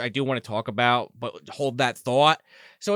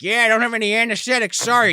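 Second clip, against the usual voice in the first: higher, quick offhand apology; mock back-alley surgeon; 7 semitones higher, 5 dB louder than usual. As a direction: imitate a careless surgeon, pitched up and hurried.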